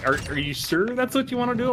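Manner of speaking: high, hesitant checking voice; doubtful DM. Retold or imitated. Sister as DM double-checking the player's choice, high and doubtful. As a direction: ask as if giving one last chance. DM sister questioning in retold game.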